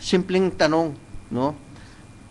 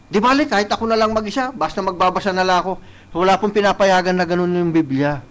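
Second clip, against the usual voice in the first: usual speaking voice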